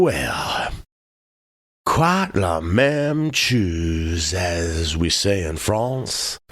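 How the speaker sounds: slow, affected drawl with long pauses; leisurely drawling gentleman; drawn-out syllables. Acted. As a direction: hem and haw, then stretch each word. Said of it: "drawing out his syllables".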